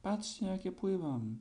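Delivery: put-on high, slow boastful baby voice; child showing off in the puddle; proud little child. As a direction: crow it like a proud tot. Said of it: "odpowiadało".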